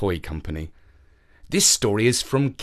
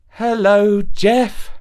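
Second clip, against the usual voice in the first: reluctant; 8 semitones higher, 5 dB louder, much slower than usual. A narrator's reading. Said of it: "she replied reluctantly".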